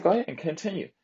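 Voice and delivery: taunting challenge. Retold or imitated. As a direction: goad him to repeat the jokes. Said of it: "And I said".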